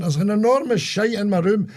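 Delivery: high-pitched mock panic; retold alarm. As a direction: report a horror, imitating a startled friend. Retelling friend's alarmed announcement.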